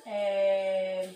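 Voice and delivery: normal talking voice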